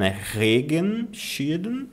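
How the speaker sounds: Regenschirm slow with stress on Re, rising; slow correct stress. Shown as a demonstration. Teacher gives the right stress to students. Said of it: "Eu coloco o acento aqui no ponto de entonação".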